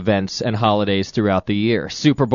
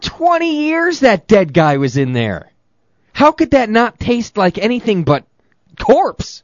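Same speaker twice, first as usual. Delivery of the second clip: high, loud disgusted rant; grossed out; 11 semitones higher, 5 dB louder than usual. Real, not acted. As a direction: exclaim in revulsion. Grossed out exclaiming.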